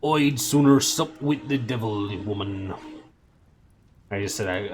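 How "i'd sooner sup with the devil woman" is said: louder, broad Scottish growl; Scottish accent. A narrator's reading. Shadwell refusing Madame Tracy, loud broad Scottish accent. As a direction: give Shadwell's accent its full weight. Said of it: "this guy's crazy accent".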